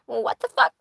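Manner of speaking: small parrot voice, not yet screaming; restrained bird impression at ordinary volume; parrot imitation. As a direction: mimic the parrot with laughter creeping in. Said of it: "and he says".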